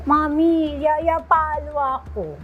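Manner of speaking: slow, put-on little-kid voice; lightly voiced toddler telling on the nanny; imitating her toddler. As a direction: tattle slowly like a three-year-old. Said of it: "sabi nung anak ko".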